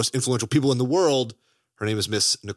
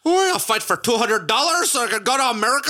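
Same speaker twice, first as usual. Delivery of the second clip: a hammed-up, much higher Irish accent; overdone Irish accent, pitched up; Tom Cruise's Irish accent; 12 semitones higher, 4 dB louder than usual. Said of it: "my pitch perfect rendition of Tom Cruise".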